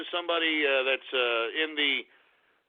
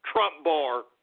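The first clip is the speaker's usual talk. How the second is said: ordinary talk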